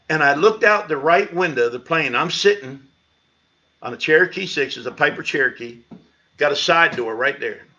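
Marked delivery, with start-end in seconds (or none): none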